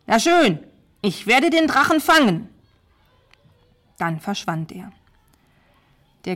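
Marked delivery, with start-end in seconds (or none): 0.1-2.5 loud, raised-pitch defiant outburst; angry shout. A narrator's reading. Knight accepts the king's dragon task, shouting defiantly. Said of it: "Er hat geschrien".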